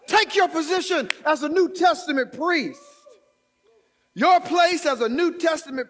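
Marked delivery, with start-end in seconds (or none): 0.1-2.8 loud and high; preaching shout. Real, not acted. Pastor urging the church to take their place, shouting. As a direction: exhort them at full voice.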